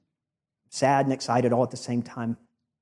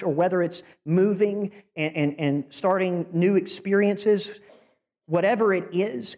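rising preaching voice; 6 semitones higher than usual. Acted.

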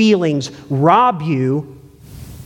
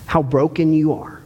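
ordinary talk, nothing marked

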